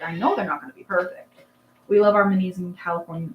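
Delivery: ordinary talk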